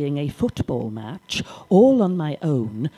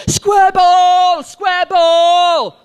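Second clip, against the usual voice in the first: loud, much higher shout of a football call; shouting from the touchline; 13 semitones higher, 7 dB louder, much slower than usual. Retold or imitated. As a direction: bellow it across the pitch like a coach. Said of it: "I did try and shout out".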